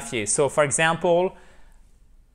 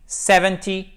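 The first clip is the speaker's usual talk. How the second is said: Seventy, higher and louder, stress on "Sev"; 4 semitones higher, 4 dB louder than usual. Shown as a demonstration. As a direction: hit the first syllable of Seventy. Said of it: "c'est la même chose".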